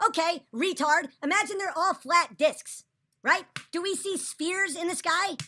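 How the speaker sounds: loud, slow and angry, jabbing each word; angry rant. Real, not acted. Streamer fed up with his chat, snapping angrily. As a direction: tear into the doubters with scorn.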